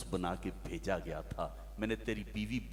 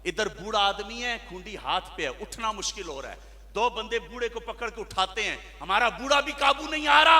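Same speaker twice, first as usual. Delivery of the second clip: rising higher and louder as he goes; mounting preaching; 11 semitones higher, 10 dB louder than usual. Acted. Preacher listing the ills of society, building up. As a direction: build the rant from telling to crying out.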